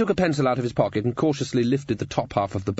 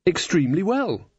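higher-pitched, puzzled reply; bewildered; 8 semitones higher than usual. A narrator's reading. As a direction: answer while still baffled. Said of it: "still bewildered".

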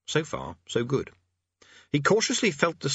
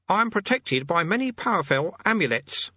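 very high, insistent warning; warning conjurer; 9 semitones higher than usual. Acted. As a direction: warn off a demon, pitched high. Hidden conjurer warns off demon.

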